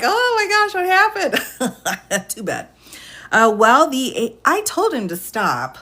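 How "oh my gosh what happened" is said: imitating stunned guest. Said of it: "that guy's probably like".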